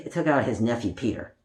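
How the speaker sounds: ordinary delivery, nothing marked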